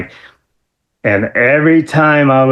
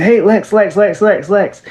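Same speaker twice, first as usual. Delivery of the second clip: put-on higher, eager kid calling a name over and over; eager fan calling; 9 semitones higher than usual. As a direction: tug for his hero's attention.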